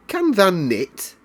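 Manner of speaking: lightly voiced Yorkshire girl, puzzled; Yorkshire maid. A narrator's reading. Martha questions Mary, Yorkshire maid.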